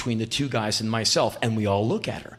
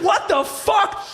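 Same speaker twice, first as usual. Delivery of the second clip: high, drawn-out cry of disbelief; astonished; 4 dB louder, slower than usual. Retold or imitated. Stunned fan reacting to a rock star, high drawn-out amazement. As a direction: stretch it out high, utterly floored.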